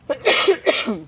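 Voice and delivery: sharp dry cough breaking the sentence; real cough. Real, not acted. Coach interrupted by his throat mid-list, coughing. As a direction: cough hard, throat tickling. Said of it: "I really apologize".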